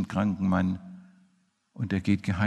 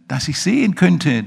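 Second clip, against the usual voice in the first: higher, louder eager reply; eager plea; 7 semitones higher, 8 dB louder than usual. Acted. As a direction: blurt out the wish. Bartimaeus answers Jesus eagerly.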